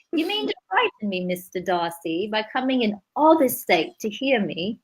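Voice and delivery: a lightly put-on Cockney accent reading Austen; hammed-up Cockney take on Elizabeth Bennet. Host reading Pride and Prejudice aloud in a mock Cockney accent. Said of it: "That's like a Cockney accent".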